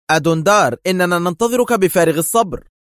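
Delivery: loud, high, eager address; urgent report. A narrator's reading. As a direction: greet the boss with pressing impatience. Henchman urgently greets awaited boss.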